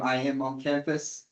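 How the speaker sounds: ordinary talk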